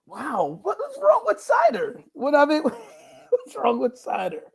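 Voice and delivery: squeaky high-pitched disbelief, half laughing; incredulous cry; mock outrage. Real, not acted. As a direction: play up the indignation with a jump way up in pitch. Indignant about cider judgment.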